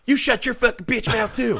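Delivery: high-pitched imitation of an angry dad turning on his wife; retold angry yell; mock aggressive husband. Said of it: "he was, like, aggressive".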